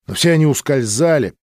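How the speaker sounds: ordinary delivery, nothing marked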